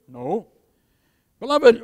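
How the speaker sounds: ordinary delivery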